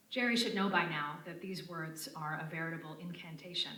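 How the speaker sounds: usual speaking voice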